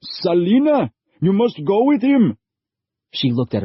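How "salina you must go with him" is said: higher, louder, forceful command; father angrily ordering his daughter away; angry father. A narrator's reading. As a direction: order her to go, angry and scared. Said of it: "Her father angrily snatched".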